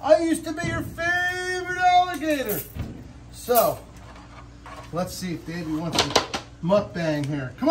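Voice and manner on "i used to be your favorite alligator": a lightly voiced jealous gator, mock-shouting; jealous alligator. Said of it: "Davey is, like, freaking out".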